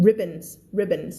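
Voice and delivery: ribbons said slowly with a clear English r and short i; pronouncing ribbons. Shown as a demonstration. Teacher modelling ribbons for Thai students, slow and clear. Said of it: "เวลาอ่าน อ่าน ribbons".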